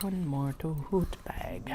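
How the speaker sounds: soft whispered chant; whispering. Real, not acted. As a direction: whisper it to yourself.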